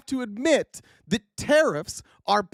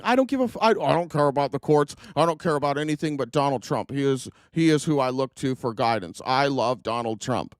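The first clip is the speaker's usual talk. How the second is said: lower, dull mock voice of a loyal official; 8 semitones lower than usual. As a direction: parody him lower and duller, a lightly voiced mouthpiece. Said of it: "finished his interview by saying".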